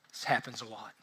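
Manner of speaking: low, very quiet, offhand remark; imitated unconcern. Dog owners explaining their lack of panic, unconcerned. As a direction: toss it away softly, totally unbothered.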